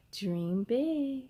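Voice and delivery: ordinary delivery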